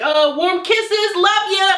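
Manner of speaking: high, slow, sing-song lovey sign-off; mock gushing affection. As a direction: imitate an over-sweet suitor, pitch way up. Mocking scammer's sudden gushing affection.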